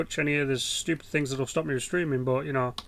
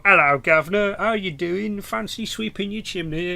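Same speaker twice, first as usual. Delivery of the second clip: chirpy stage-cockney chimney sweep, pitched up; mock cockney; 4 semitones higher, 5 dB louder than usual. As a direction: ham up a fake cockney accent.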